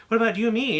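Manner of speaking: a needy mock plea; whiny plea. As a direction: ask it in a high, jealous whine. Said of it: "And it's like".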